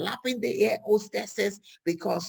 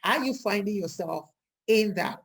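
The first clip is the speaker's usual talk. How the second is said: ordinary talk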